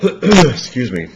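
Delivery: hoarse, losing her voice; strained hoarse voice after clearing throat. Real, not acted. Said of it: "losing my voice".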